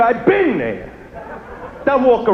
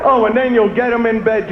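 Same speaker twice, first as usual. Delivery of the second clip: ordinary delivery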